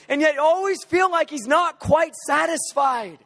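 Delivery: full-force preaching. Acted. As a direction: preach it out over the room, fervent and high. Leads congregation in fervent prayer.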